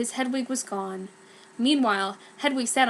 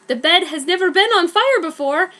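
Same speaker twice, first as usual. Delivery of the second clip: startled, higher and louder; alarmed; 7 semitones higher, 8 dB louder than usual. Acted. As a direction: blurt it out in alarm. Harry alarmed by fire.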